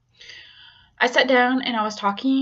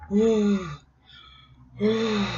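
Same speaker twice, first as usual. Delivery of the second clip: long breathy "ooh" moans; moaning. Retold or imitated. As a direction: moan it out slowly, as if breathing through pain. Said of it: "doing the moaning thing".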